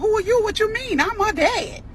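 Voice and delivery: high, loud, outraged demand; indignant dad yelling. Retold or imitated. Second dad shouting at the stranger, indignant yell. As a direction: fire back like an offended father.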